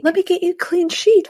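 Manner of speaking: high, slow, fake-sweet; overly sweet like acting; fake and weird. Friend imitating Miranda's fake sweetness, high and slow. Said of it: "totally fake and weird".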